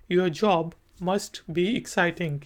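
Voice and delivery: slow, clear spoken example; slow model reply. Shown as a demonstration. Teacher models how the student should answer. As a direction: say it slowly so students can copy it. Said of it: "तो आगे से आप क्या कहेंगे".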